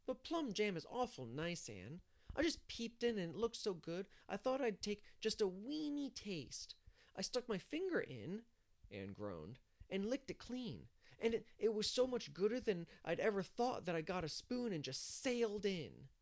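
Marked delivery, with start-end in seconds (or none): 0.1-8.5 put-on high-pitched, eager child voice; little boy Davy, confessing. Narrator voices small boy Davy. 9.9-16.1 put-on high, loud, gleeful child voice; Davy, relishing it. Narrator voices Davy confessing.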